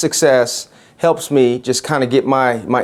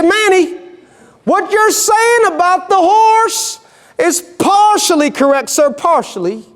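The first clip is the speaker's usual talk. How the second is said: high East Texan cowboy correcting someone; East Texan accent. Acted. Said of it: "in this rich East Texan accent".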